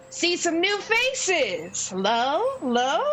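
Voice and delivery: high gnome showman greeting newcomers; small host's big hello; booming gnome. Acted. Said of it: "bizarrely booming voice".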